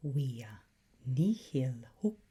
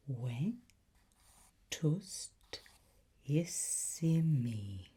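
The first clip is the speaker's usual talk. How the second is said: Vetustissimi drawn out syllable by syllable, V said as W; broken down slowly; much slower than usual. Shown as a demonstration. Tutor breaking the long word down for the listener. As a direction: whisper Vetustissimi very slowly, piece by piece. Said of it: "Break that word down".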